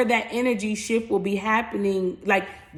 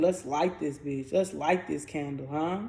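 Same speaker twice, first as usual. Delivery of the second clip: low murmured echo; 5 semitones lower, 6 dB quieter than usual. Acted. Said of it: "I just keep hearing".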